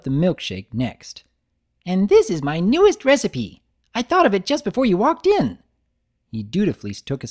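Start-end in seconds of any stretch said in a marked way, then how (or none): 1.8-5.6 high, loud, bouncy greeting; Pinkie Pie voice. Acted. Pinkie greeting Big Mac at the counter, high and bubbly. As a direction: burst in cheerfully.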